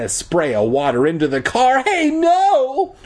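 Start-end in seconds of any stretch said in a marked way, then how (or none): 1.8-3.0 very high, drawn-out wail. A narrator's reading. Wart seeing his parents' car sprayed, wailing. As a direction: wail it out in high-pitched dismay. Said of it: "Wart wailed".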